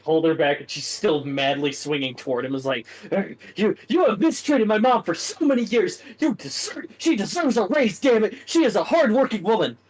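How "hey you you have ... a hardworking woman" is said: high, loud, furious rant; furious yelling. Acted. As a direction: scream it at him, out of control. Stacy raging at Stuart.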